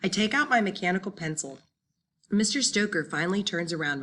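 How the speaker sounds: ordinary delivery, nothing marked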